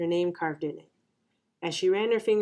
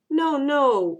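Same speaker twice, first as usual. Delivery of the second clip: higher, louder protest; insistent correction; 7 semitones higher, 6 dB louder than usual. A narrator's reading. Eunhae corrects the teasing kids, insistent. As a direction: correct them firmly, raising pitch. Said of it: "Eunhae corrected".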